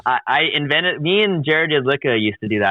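ordinary delivery

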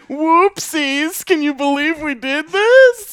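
high, loud fake-surprised corporate voice; mock innocent company voice. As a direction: act shocked at your own obvious scheme. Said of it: "It's like".

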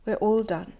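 ordinary delivery

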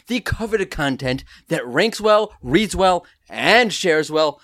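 upbeat announcer read over music; podcast host intro. Acted. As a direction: sell the show like a radio host.

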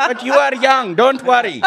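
put-on high, loud comic Indian accent. Uncle reassuring his young niece, broad mock Indian accent. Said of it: "I have to do Apu from The Simpsons".

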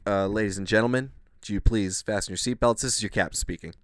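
captain announcement. Pretend. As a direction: speak like an airline captain. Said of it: "Watch this, watch this".

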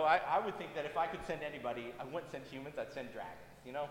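usual speaking voice

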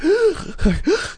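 sharp high gasping intake; mock gasp. Retelling an allergic reaction, acting out a high gasp. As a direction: gasp like your throat is closing. Choking on oil during massage.

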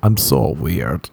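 low, drawn-out "so weird"; mock LA beautiful person. Beautiful people in LA describing themselves, in a mock affected voice. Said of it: "Beautiful people in L A, they'll say stuff like".

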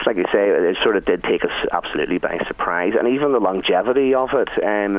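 ordinary delivery

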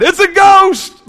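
high, drawn-out cry of alarm; frightened cry. A narrator's reading. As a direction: cry out like terrified disciples in a Bible reading. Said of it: "they cried".

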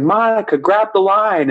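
high-pitched mock yell, no real volume; retold yell. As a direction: act out calling urgently to someone out on a line. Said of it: "I start yelling at her".

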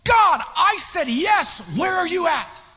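loud, slow, accusing cry; shouted demand at God. Retold or imitated. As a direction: call out to a silent God. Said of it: "I'm going".

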